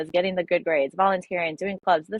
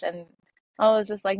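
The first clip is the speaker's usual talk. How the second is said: normal talking voice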